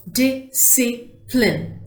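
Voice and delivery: "Discipline" slowly as di, sci, pline; three separate beats on "Discipline"; split into syllables. Shown as a demonstration. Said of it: "three syllables".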